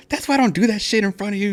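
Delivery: high-pitched imitation of an upset wife; mock wife's complaint. Wife woken by her own fart scolds husband, high-voiced. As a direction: push the voice up high in protest.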